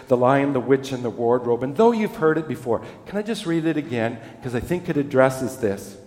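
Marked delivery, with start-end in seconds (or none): none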